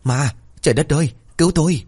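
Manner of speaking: high, panicked cry for help; stammering in terror. A narrator's reading. Frightened woman alone in the dark, high panicked cry. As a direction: gasp it out, terrified. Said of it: "bà ta lắp bắp".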